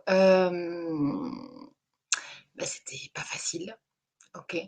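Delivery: near tears, dropping to a whisper; choked up. Real, not acted. To her viewers, sad about the cancelled festival, whispering through tears. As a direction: voice breaking into a whisper.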